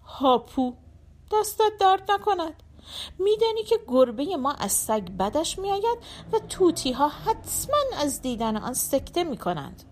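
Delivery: twist the mouth and thank him sourly; sarcastic wife. Acted. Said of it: "همسرم دهانش را پیچاند و گفت".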